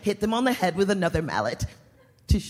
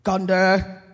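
loud, booming villain voice; dark lord; 6 dB louder than usual. Acted. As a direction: declare it like a smug evil lord. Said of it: "And the Dark Lord spake".

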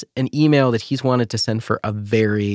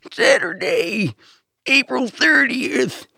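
put-on very high, drawn-out old man announcer; an old man straining at monster truck voice; old man's monster truck voice; much slower than usual. Co-host correcting the impression, very old man's monster truck voice. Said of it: "very old man doing monster truck voice".

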